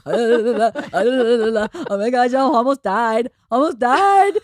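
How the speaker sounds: mock-traumatized outburst; retold shaken panic. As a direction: act out being badly shaken up. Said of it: "I'm so shaken up".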